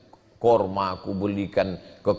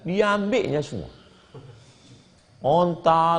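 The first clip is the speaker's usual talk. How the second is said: ordinary talk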